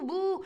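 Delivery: high, loud jeering boos; mock booing. Shown as a demonstration. Teacher boos to show how people jeer. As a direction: boo like a hostile crowd. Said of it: "on fait bouh".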